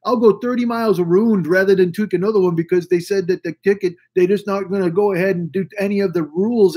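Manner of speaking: exaggerated Canadian rant; Canadian accent. Friend swearing off roundabouts, Canadian accent. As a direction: imitate an indignant friend in a mock Canadian accent. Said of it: "that's me doing a Canadian accent".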